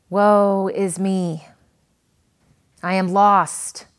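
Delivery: slow, loud, broken lament of guilt; anguished cry of despair. Acted. Isaiah, head bowed before God, crying out in despair. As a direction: cry it out slowly, weighed down by guilt. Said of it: "a painful cry of despair".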